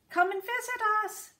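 high, calling out goodbye; little dassies calling back to family; shouting. Acted. Dassies shouting goodbye to their family as they leave. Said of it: "they shouted".

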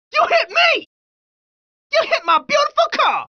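high, indignant protest; indignant outrage. Acted. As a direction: protest the crash in a high, offended voice. Driver acting outrage after a crash.

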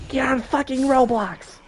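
high, mocking yell of a whiny classmate; mock friend yelling. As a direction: throw it out like the kid nagging you at school. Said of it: "every single day he just goes".

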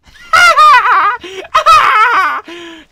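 loud high villain cackle; evil cackle. Acted. Doctor cackles in the kitchen, loud high laugh. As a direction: let out a wild mad laugh.

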